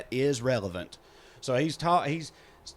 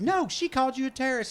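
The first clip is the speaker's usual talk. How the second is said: upset; 11 semitones higher than usual. Retold or imitated. Lady protests to her husband.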